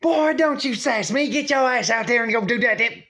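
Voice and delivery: put-on high, loud old-lady scold; scolding grandma. Grandma scolding her grown son for sass, high and loud. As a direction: snap at him not to sass you.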